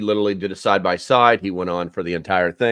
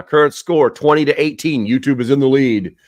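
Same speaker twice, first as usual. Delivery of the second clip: high, loud scoreboard call; playful, raised-voice match update; mock score announcer; 5 semitones higher, 6 dB louder than usual. Mock sports score update on stream.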